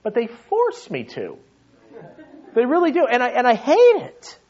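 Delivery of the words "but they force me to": normal talking voice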